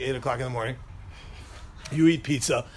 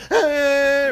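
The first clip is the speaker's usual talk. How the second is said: imitated whine; 9 semitones higher, 5 dB louder than usual. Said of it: "kinda like your little brother".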